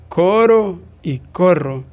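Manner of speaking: "coro" with a tapped r against "corro" with a trilled rr, slow and loud; minimal pair contrast. Shown as a demonstration. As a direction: say "coro y corro" slowly, tapping one r and trilling the other. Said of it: "Por ejemplo".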